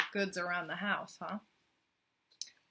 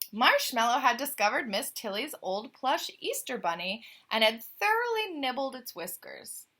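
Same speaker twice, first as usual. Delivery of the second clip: clear, louder storybook reading; story narration; 7 dB louder than usual. A narrator's reading. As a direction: read the tale out to the listener. Reads the picture book aloud.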